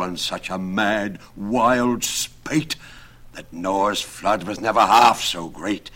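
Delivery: loud, portentous prediction; grand, slightly hammed-up prophet; Nicholas prophesying doom. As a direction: proclaim a coming catastrophe with mounting drama.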